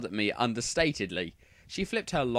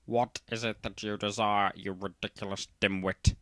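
hammed-up Snape sneering at a student; cold Snape voice; 4 semitones lower than usual. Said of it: "Snape demeaned angrily in his cold voice".

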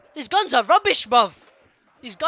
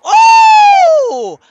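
very high, loud drawn-out "oh"; long falsetto scream of delight; excited shriek; 14 dB louder than usual. Real, not acted. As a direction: let out a piercing squeal. Thrilled by new weapon.

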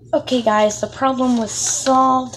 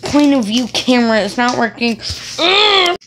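loud, high frustrated whine; 3 semitones higher, 7 dB louder than usual. Real, not acted. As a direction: whine at a broken camera. Camera not working, frustrated.

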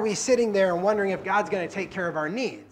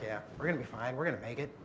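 softer, low and unbothered; lightly voiced Jesus shrugging off the storm; a touch of easy reassurance to scared disciples; calm Jesus; 4 semitones lower, 6 dB quieter, faster than usual. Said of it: "He was like".

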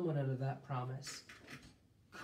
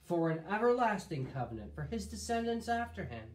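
scripture reading; 3 semitones higher than usual. Acted. Reads God's words in scripture.

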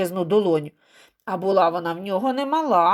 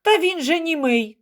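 high, moderately loud exclamation; protesting cry; 8 semitones higher, 3 dB louder than usual. A narrator's reading. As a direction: cry out in the man's defense. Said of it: "крикнув Іван".

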